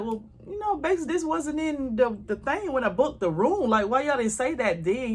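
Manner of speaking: mock-indignant, pitched far up; high, sassy objection to the desk; indignant complaint. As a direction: play the offended guest protesting the parking fee. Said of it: "So I'm like".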